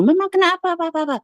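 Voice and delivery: high, loud retold alarm; mock-panicked questioning, high and urgent; imitated worried children.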